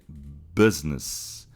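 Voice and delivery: "business" with an open i close to E; open vowel business. Shown as a demonstration. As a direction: say "business" as "bez-nes". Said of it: "é um pouco mais aberto".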